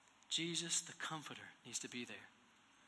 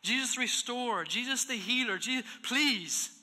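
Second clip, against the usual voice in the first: pleading prayer; 6 semitones higher, 8 dB louder than usual. Retold or imitated. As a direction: pray it out, begging and aching. Pastor voicing his prayer at bedside.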